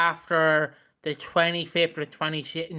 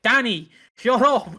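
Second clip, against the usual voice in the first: louder, higher, drawn-out scolding; hammed-up exasperation; exasperated mock telling-off; 5 semitones higher, 5 dB louder, slower than usual. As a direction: play a director at the end of their patience. Imagined exasperated director scolding actor.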